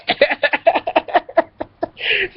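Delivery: can't stop giggling at it; high giggling laugh. Real, not acted. Cracking up retelling the fart story.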